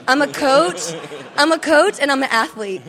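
loud, rising coach yell, repeated; coach shout. Acted. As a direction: bellow it like on the court. Joking about being a coach.

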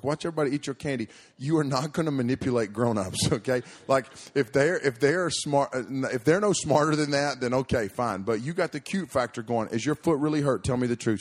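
put-on loud, fast telling-off of a child; scolding lecture. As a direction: lay down the law to a little con artist.

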